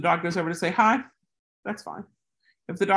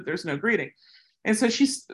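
ordinary talk

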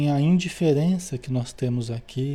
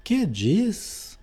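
startled, higher-pitched question; aghast question; 4 semitones higher than usual. A narrator's reading. Narrator asks a spirit about a new war, aghast. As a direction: ask it with a touch of dread. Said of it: "indaguei aterrado".

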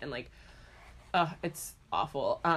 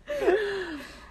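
high laugh. Real, not acted. Laughing after the joke.

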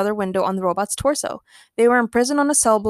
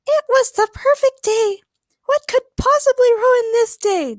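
put-on very high, loud girlish falsetto; dreamy Daniela; 7 dB louder than usual. A narrator's reading. Daniela basking in a perfect day on the beach, dreamy. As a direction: draw it out slowly in a high sing-out voice. Romantic heroine voiced by narrator.

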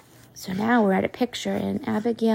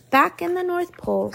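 ordinary delivery

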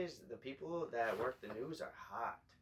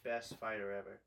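ordinary talk, nothing marked

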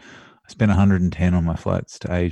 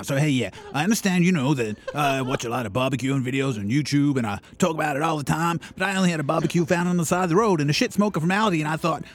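put-on American accent; 7 semitones higher than usual. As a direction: hype it up, American style. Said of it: "the accent changes as it goes".

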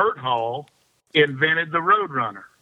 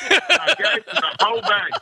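much higher, laughing; 7 semitones higher than usual. Real, not acted. Storyteller handing Woo the lures, laughing hard. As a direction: deliver the punchline cracking up.

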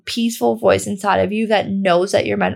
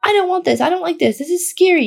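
high, whiny tantrum voice; pitched-up childish protest; whiny inner child; 8 semitones higher, faster than usual. Retold or imitated. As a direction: play a scared little kid throwing a fit. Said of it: "inner child who's, like, having a temper tantrum".

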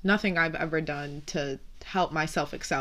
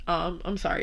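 ordinary talk

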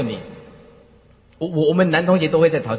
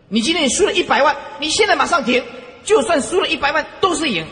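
higher and louder, pressing the paradox; raised insistent sermon voice; forceful preaching; 10 semitones higher, 4 dB louder than usual. Real, not acted. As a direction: hammer the point home to listeners.

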